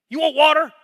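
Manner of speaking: high loud challenge; enraged Moses shouting at the crowd; angry shout. Retold or imitated. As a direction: hurl the question in fury. Moses yells before striking.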